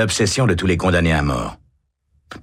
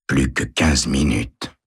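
low, slow moan; 4 semitones lower, slower than usual. A narrator's reading. Condemned man at his cell bars, moaning low and slow. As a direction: moan it out in dread. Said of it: "gémit-il".

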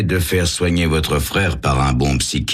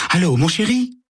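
high, cooing lover's voice; wife-like purr to her lover; sweet honeyed voice; 12 semitones higher than usual. Acted. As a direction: coo it sweetly down the phone. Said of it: "d'une voix que Sam a du mal à reconnaître".

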